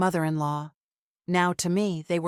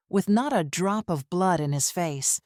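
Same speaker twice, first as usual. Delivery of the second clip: ordinary delivery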